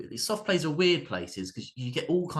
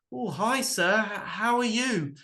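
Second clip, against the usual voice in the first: much higher, slower awkward greeting; a bashful ex-pupil spotting his teacher; teenage supervisor; 10 semitones higher, slower than usual. Retold or imitated. Teenage supervisor greeting his old teacher, high and hesitant. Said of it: "recognises me and goes".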